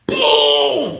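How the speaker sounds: high, drawn-out crash sound effect; imitated impact boom. Storyteller imitating the officer's tackle, drawn-out boom. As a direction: make the hit land with a long boom.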